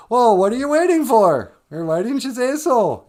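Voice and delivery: very high, loud cartoonish shout; mock Muppets. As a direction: burst in as a crowd of puppets. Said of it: "And then all the Muppets".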